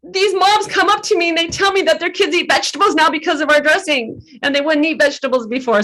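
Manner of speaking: high, breaking voice, near tears; choked-up voice climbing high and cracking; tearful retelling. Retold or imitated. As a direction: relive the sobbing answer, voice cracking high. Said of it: "I'm bawling my eyes out saying that".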